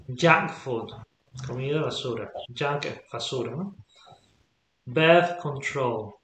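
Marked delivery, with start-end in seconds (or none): 4.8-6.1 Birth control said very slowly; slow model phrase. Shown as a demonstration. Teacher reads example slowly to student. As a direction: draw Birth control out clearly. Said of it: "teacher models compound nouns".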